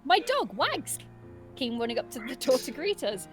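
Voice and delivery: ordinary talk, nothing marked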